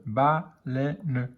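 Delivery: "baleine" slowly split as ba-lei-ne; syllable by syllable. Shown as a demonstration. Teacher decoding for beginning readers, slow syllable by syllable. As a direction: sound out "baleine" with a gap after each syllable.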